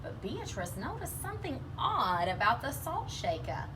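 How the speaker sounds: usual speaking voice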